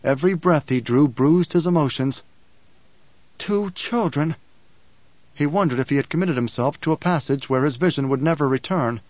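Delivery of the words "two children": wonderstruck. A narrator's reading. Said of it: "Paul marvels alone".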